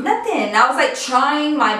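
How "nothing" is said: fake-casual "nothing". Feigning she's fine to boyfriend.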